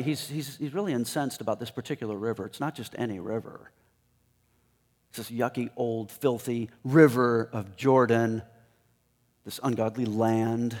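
usual speaking voice

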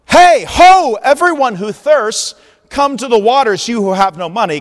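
high, loud vendor's cry; heralding offer. Acted. Prophet offering the waters, a loud heralding cry. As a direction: hawk it to the passing crowd. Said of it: "he puts something on offer".

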